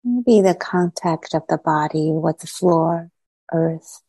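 unhurried meditation guide, long silences between phrases; guided meditation. Acted. Teacher easing meditators gradually into body, mind and heart, guided meditation. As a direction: guide them into settling, phrase by phrase.

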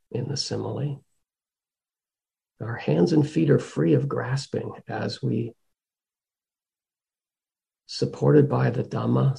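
calm meditation guide, long pauses between phrases; guided meditation. Real, not acted. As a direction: guide them gently inward, leaving space after each phrase.

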